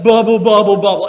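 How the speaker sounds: loud, bouncy bubble, bubble; playful loud bubbling for the kids; bubbly jellyfish sound. Shown as a demonstration. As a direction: pop each bubble like a jellyfish. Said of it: "I want you to go".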